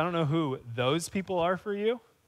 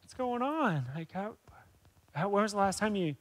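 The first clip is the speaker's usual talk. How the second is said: put-on softer, tender voice for a scared child; gentle comfort; 2 semitones higher, 6 dB quieter than usual. Adult comforting a lost two-year-old at the store, soft and tender. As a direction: kneel down and soothe him, dropping to a near whisper. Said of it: "come over and get down there like".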